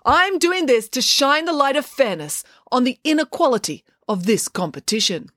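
earnest campaign oratory; public speech. A narrator's reading. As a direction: proclaim your cause to a crowd. Maya's speech to the crowd.

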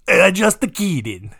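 put-on jokey, drawn-out Italian accent; playful botched Russian that comes out Italian; accidental Italian accent. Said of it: "It's, it's Italian".

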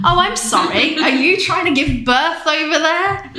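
high, sarcastic mock apology. Mocking her tired husband, high sarcastic voice.

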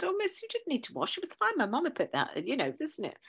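lightly imitating the girl's bashful reply; sweet pupil. The pupil gently protesting about the washed jumper, lightly voiced. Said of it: "this girl who said".

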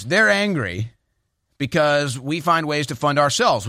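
ordinary delivery

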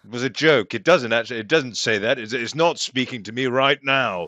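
insistent, protesting denial; acted flustered denial; defensive backpedalling. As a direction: protest a little too much. Backtracking about the evil sword.

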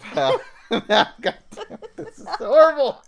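loud, high, laughing exasperation; words bursting out through real laughter; cracking up. Real, not acted. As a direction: lose it laughing halfway through. Cracking up mid tongue twister.

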